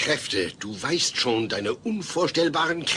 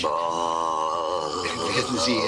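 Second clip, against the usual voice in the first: stalling; 6 semitones lower, much slower than usual. Acted. Stalling, searching for words.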